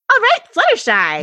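high, loud cartoon cheer; excited cheer. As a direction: cheer her on brightly. Cheering character line.

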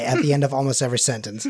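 ordinary delivery

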